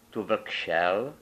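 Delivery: plain reading voice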